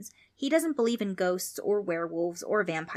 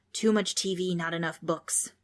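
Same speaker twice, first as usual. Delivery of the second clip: usual speaking voice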